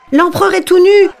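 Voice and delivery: high, loud laughing shout; crowd shouting. A narrator's reading. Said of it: "qui se mit à crier". (cri moqueur de la foule, aigu et fort)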